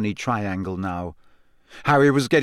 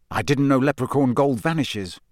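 ordinary delivery, nothing marked

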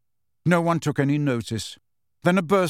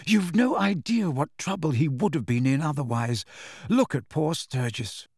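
tearful gratitude; 4 semitones higher than usual. Acted. Grateful mother, emotional after crisis.

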